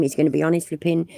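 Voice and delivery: ordinary delivery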